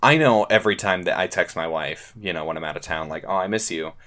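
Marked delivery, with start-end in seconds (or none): none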